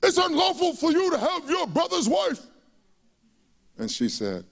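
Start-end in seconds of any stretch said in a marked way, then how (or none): 0.0-2.5 bold prophet rebuke. Retold or imitated. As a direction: call out the king boldly. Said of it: "And he says".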